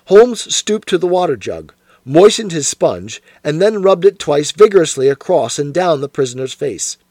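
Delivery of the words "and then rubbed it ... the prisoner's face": usual speaking voice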